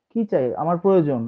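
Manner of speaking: normal talking voice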